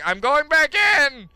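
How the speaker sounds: high, loud, slow insistence; panicked declaration. Real, not acted. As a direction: cry it out in mock panic. Panicking, turning back.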